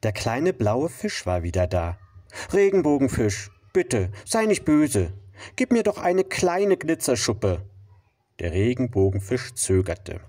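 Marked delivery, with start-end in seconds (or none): 2.5-7.6 high, small pleading voice; pleading little fish. Acted. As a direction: beg sweetly in a high voice.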